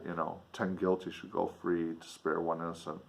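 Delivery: plain reading voice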